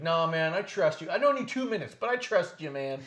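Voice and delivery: loud, high, emphatic reassurance; imitated trusting wrestler. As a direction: imitate a guy insisting he trusts you. Imitating the wrestler while retelling.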